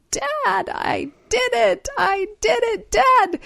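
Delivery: put-on high, loud little-girl calls; excited child yelling. Mother imitating daughter yelling for dad. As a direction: shout it like a kid running through the house. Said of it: "went racing through the house yelling".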